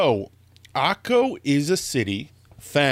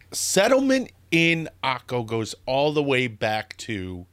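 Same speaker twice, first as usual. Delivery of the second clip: ordinary talk, nothing marked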